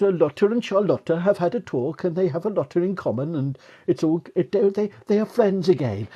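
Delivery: high, loud generic Scandinavian voice; chirpy Scandinavian chair announcing peace; Scandinavian accent. Retold or imitated. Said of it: "I'm gonna try and do a sort of generic Scandinavian voice now".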